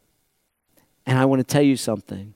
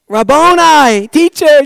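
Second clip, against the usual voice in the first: high, loud, overjoyed shout; elated loud "Rabboni"; joyful recognition cry; 12 semitones higher, 10 dB louder, slower than usual. Acted. Mary recognizes Jesus.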